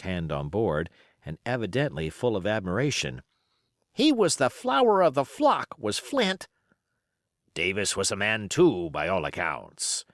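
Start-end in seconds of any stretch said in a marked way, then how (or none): 3.9-6.5 put-on high, eager young seaman; young sailor. Youngest hand admiring Flint, high and eager. 7.5-10.0 put-on old pirate. Silver's pirate voice.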